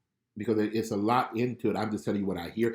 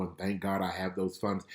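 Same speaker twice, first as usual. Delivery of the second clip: ordinary delivery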